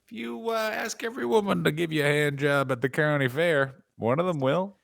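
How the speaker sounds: lightly voiced hillbilly, opening high; a hint of country bumpkin; mock yokel advice. Answers in matching yokel voice.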